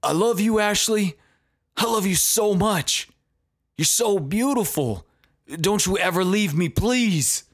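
high, pleading voice for Johnny; Johnny yelling. Retold or imitated. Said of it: "Johnny began yelling".

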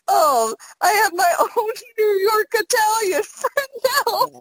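very high, drawn-out squeal through giggles; gleeful laughter. Real, not acted. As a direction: gush while cracking up. Recalls gushing, cracking up.

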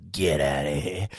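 lightly voiced director shooing him out; a hint of a gruff director; director dismissing. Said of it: "He goes".

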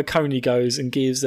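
ordinary talk

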